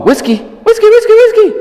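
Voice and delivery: very high, loud, squealing puppy talk; excited high-pitched coaxing; playful excited call. Shown as a demonstration. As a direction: hype the dog up to chase you. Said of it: "So I'll".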